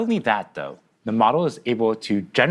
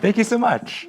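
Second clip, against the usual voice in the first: laughing; 12 semitones higher than usual. Real, not acted.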